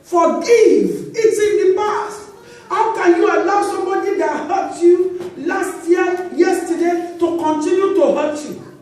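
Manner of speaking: loud, urgent sermon push; forceful preaching. Acted. Pastor urging congregation to forgive, loud preaching.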